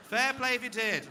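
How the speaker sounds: high, loud praise shouted a second time; loud cheer again. Real, not acted. Repeats the cheer to the crowd.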